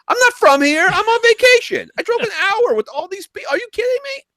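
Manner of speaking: whiny furious tourist complaining to staff; angry tourist ranting at the theater clerk; angry customer. Retold or imitated. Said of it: "I was so angry".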